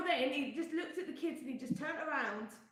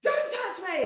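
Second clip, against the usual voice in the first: retold shout; 7 semitones higher, 7 dB louder than usual. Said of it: "he was like".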